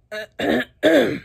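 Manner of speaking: real loud hard throat clearing; clearing pepper powder from the throat. Real, not acted. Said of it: "I'm clearing my throat so much".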